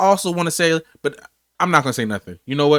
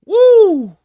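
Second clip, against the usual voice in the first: high, loud whoop; a big celebratory holler; cheering woo; 8 dB louder than usual. Real, not acted. Cheering a good stat.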